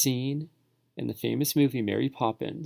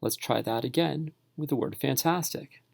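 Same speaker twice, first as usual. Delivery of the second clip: ordinary talk, nothing marked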